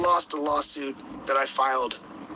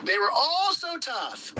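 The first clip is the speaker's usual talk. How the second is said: taunting; 10 semitones higher, slower than usual. Real, not acted. Taunting the hiding defendants.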